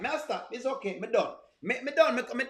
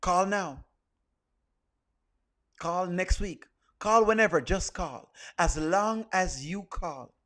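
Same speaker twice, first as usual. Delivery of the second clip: low, measured voice-over pitch; deep-pitched announcer with dramatic pauses; infomercial announcer; 5 semitones lower, slower than usual. Acted. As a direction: sell it like a mock TV appeal, voice dropped low.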